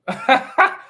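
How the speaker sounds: short high giggle breaking out; high laugh. Real, not acted. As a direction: let a quick high laugh slip. Laughs at own jab.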